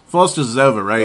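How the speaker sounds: put-on light Australian accent; a hint of Australian twang. Host riffing on Foster's in a light Australian accent. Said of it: "add a speak Australian".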